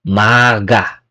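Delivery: maga, corrected take; correct maga again. Shown as a demonstration. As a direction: land on maga with a voiced G. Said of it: "Not mak-a, maga".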